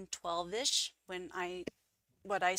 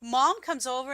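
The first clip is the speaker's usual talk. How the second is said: brother's casual reply; 8 semitones higher, 7 dB louder than usual. Retold or imitated. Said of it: "And he's like".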